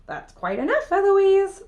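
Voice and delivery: mocking echo that shoots up high on the name; mimicking the tutor. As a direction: copycat taunt. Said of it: "And I say".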